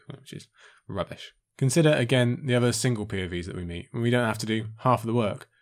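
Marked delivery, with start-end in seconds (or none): 0.1-1.3 muttered under the breath, slow; whispered aside. Real, not acted. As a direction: grumble it half to yourself. Grumbled aside after a complaint.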